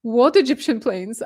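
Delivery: acted disbelief, pitch jumping on the question; incredulous reaction. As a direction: play someone baffled by the news. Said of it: "normal human reaction would be like".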